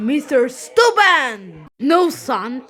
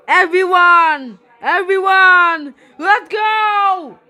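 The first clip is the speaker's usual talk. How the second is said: loud, high call to the crowd; rallying call; 6 semitones higher, 8 dB louder than usual. Pretend. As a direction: rouse everyone to go. Cook rallies everyone in story.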